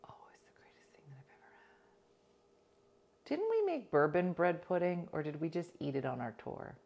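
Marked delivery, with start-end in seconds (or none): 0.1-1.7 fast, hushed whisper of bliss; breath only, savoring the memory; awed whisper. Real, not acted. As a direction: whisper it as if swooning. Swooning over remembered monkey bread.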